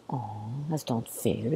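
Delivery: hushed, slow aside; low, near-whispered commiseration; soft sympathy. Real, not acted. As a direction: say it softly and slowly, feeling for him. Reader pities rejected kitten.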